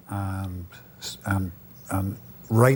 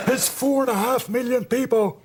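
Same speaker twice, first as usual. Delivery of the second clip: much higher, exasperated mock reply; telling an American how small Ireland is; exasperated retort; 12 semitones higher than usual. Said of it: "Ireland's that size".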